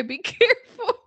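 can't hold back the giggle; laughing while quoting. Real, not acted. Laughing retelling husband's line.

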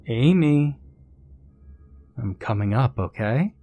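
high called name, then easing down; taunting. Acted. Pursuer announces his climb to hidden girl, taunting. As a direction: call out teasingly, then lightly warn.

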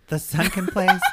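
laughing disbelief. Real, not acted. Laughing at friends' confusion.

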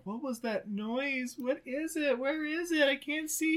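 high, slow quiet nervous voices; scared kids in the dark. Retold or imitated. Podcaster voicing frightened ballet kids, high and soft. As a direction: whimper like kids in a blackout.

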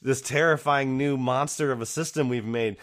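nagging adults. Retold or imitated. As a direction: imitate anxious bosses piling on demands. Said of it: "all the adults in the room are like".

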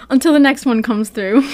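laughing reading. Retold or imitated. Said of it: "reading Camilla".

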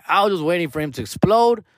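ordinary talk, nothing marked

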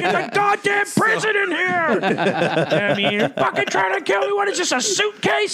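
very high, loud mock yell at his wife. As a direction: shout it like the heat is killing you. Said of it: "I'm yelling at my wife".

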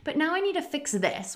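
high, fussy mock inner critic; a lightly mocked perfectionist thought; self-critical inner voice. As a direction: play the nagging voice in your head, pitched high. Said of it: "you're like".

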